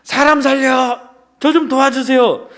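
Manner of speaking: acted panicked call for rescue; shouting for help. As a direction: cry out again in fear. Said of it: "다시 소리를 쳤어요".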